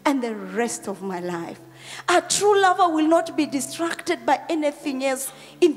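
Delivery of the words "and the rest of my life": low, hushed, heartfelt prayer; emotional devotion. Real, not acted. Pastor moved while talking of God's love, emotional prayer. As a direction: sink low and pray it tenderly. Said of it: "it really makes me emotional".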